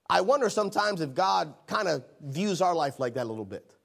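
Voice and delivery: normal talking voice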